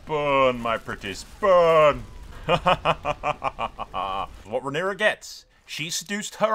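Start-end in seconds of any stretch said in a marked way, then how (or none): 0.1-2.0 gleeful villain, last burn belted out much louder; mock villain relishing the flames; cackling villain. Gleeful villain burning a city. 2.1-4.4 gleeful hammed-up villain cackle; playful mock-evil laugh. Villain laugh after burn line.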